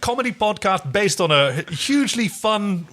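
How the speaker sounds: sarcastic, bright and high, piling on the irony; ironic praise. Real, not acted. Co-host mocks the bleak episode, selling it as fun. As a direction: oversell it with mock cheer.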